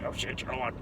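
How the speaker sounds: very quiet, under the breath; muttered aside. Retold or imitated. Storyteller recalling the old lady's line, muttered aside. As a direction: toss it away almost inaudibly.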